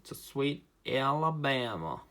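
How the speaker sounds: slow Southern drawl slipping into Australian; Australian-sounding accent. Shown as a demonstration. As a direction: stretch Alabama in an Australian-tinged twang. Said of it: "That sounded Australian".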